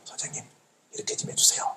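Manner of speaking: a tiny breathy whisper of a polite favour; quiet request. Retold or imitated. Citizen asks an official for help without shouting, whispered. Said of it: "조용히 말씀드려도".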